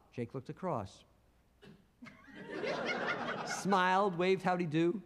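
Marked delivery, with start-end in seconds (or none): 2.4-3.4 very high, soft rising giggle. Acted. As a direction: laugh cheekily at the cop. Jake laughs at trooper.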